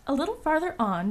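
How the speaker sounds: ordinary delivery, nothing marked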